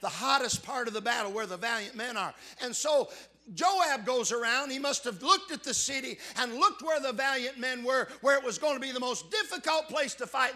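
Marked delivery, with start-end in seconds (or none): 2.6-10.5 loud, high preaching cry; fiery pulpit delivery; full-force preaching. Acted. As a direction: preach it out to the back pews.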